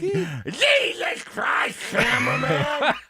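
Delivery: high, very slow shouted coach impression; hammed-up Lou Holtz shout; Lou Holtz yelling. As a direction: stretch the yell like a startled coach. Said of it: "Give me the cameraman one more time".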